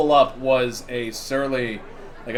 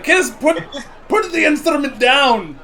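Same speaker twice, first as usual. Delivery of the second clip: higher, louder yell; dwarf yelling; 11 semitones higher, 6 dB louder than usual. Acted. Dwarf yells at the kobold to drop the lute. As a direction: bark the order at the kobold. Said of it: "It's like".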